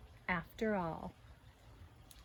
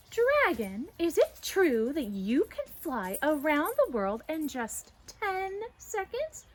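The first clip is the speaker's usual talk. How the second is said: flattering challenge; 6 semitones higher, 6 dB louder, slower than usual. Acted. Challenging dragon.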